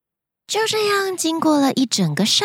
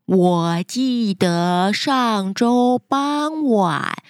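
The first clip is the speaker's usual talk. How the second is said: slow, lower, drowsy sloth drawl; super slow sleepy sloth; 4 semitones lower, much slower than usual. Acted. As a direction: stretch every word like a sleepy sloth. Sloth character speaks slowly.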